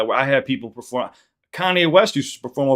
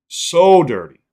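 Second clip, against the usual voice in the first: loud, high exclamation of disgust; emphatic outburst; 6 semitones higher, 10 dB louder, much slower than usual. Real, not acted. Streamer fuming at YouTube over Ren's video, loud outburst. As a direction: shout it in disbelief. Indignant repeat about YouTube.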